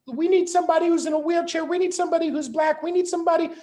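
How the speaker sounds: high, fast mocking whine; mock high whiny voice. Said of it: "by going".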